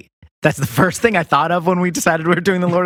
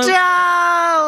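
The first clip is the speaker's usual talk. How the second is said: shout; 13 semitones higher than usual. Acted. Frodo's cry mocked.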